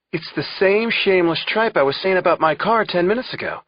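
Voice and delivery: high, animated Irish brogue. Acted. Tom keeps mimicking Mac to Clarence, Irish brogue. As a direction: keep the mock Irish brogue rolling. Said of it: "in my best Irish brogue".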